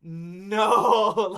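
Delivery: long, loud drawn-out "oh no"; hammed-up dismay; retold dismay. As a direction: groan it out loudly in mock dread. Retold dismayed reaction to the plan.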